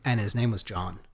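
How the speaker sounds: usual speaking voice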